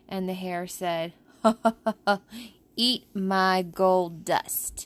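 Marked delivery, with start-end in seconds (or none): 1.4-4.9 slow, drawn-out cocky taunt; trickster tale voices. Acted. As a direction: lean on each word like a smug hare. Hare taunts tortoise.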